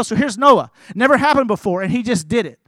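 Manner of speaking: ordinary talk, nothing marked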